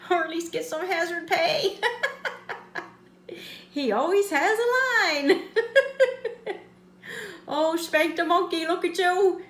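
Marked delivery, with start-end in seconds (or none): 1.8-2.8 high airy giggle; breathless high-pitched giggle; laugh. Real, not acted. Laughing at own joke. 5.5-6.6 high-pitched laugh; bursting into a high laugh. Real, not acted. Laughing at own joke.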